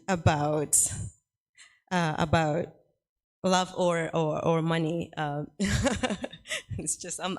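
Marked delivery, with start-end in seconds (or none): 5.5-6.3 real chuckle at something funny; amused laugh. Real, not acted. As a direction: laugh lightly because it strikes you as funny. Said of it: "I'm just laughing because it's funny".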